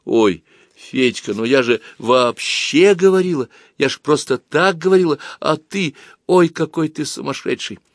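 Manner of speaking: a hint of a flustered girl; girl's voice. A narrator's reading. Nata's voice in dialogue.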